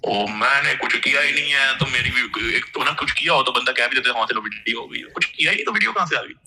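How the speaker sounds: ordinary talk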